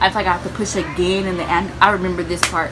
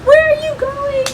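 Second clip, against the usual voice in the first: loud, very high, drawn-out yell; screaming in pain; 9 dB louder than usual. Retold or imitated. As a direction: scream after someone walking off. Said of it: "I'm screaming in pain".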